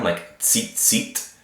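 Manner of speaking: tzit said with a sharp ts onset; TZ sound demo. Shown as a demonstration. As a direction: model tzit, tzit with a crisp ts. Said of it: "pronounced with a T Z sound".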